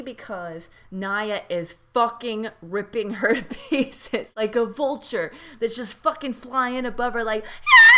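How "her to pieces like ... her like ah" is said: a sudden high shrieking bird cry; vulture screech. Pretend. Recapping Naya tearing into Sarah, vulture screech.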